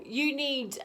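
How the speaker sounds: ordinary talk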